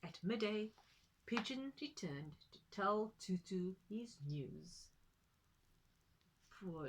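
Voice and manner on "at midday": ordinary delivery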